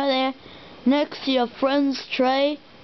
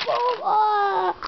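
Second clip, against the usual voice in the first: put-on high kid's boom and yelp; playful high boom and cry; pretend explosion yell; 8 semitones higher than usual. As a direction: make the blast noise then yelp. Explosion sound then scream.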